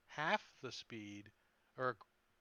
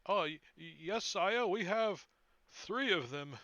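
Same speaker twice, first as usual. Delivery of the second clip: high, louder flustered servant; nervous stammer; 5 semitones higher, 7 dB louder than usual. Acted. Nervous wizard stammers reply.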